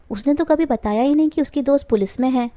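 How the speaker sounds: usual speaking voice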